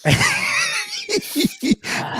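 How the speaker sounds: big high burst of laughter. Real, not acted. Loud laugh at own story.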